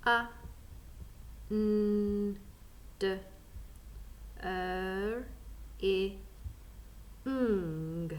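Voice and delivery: A, N, D, R, I, ng as separate sounds with pauses; sounding out. Shown as a demonstration. Teacher asks learners to work out the word, sounding out. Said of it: "Ready to sound out".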